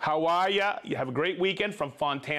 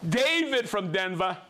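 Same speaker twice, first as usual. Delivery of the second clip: usual speaking voice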